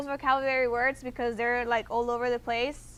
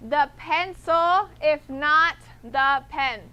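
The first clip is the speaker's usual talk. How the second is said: "if not" slow and deliberate, with "not" pressed down; stressed "not"; 4 dB louder, slower than usual. Shown as a demonstration. Teacher contrasting the other wording, stressing "not". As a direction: lean slowly on "not" in "if not the pen". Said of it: "Or you can say".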